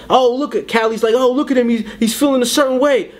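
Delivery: high, teasing mock voice; mocking taunt. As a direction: taunt him in front of the crowd.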